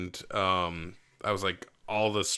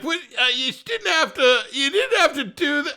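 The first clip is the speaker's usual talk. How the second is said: high, louder half-crying whine through laughter; whiny laughing protest; 4 dB louder than usual. Pretend. Friend imagining reacting to wasted food, whining through laughs. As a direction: plead while cracking up. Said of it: "I'd be like".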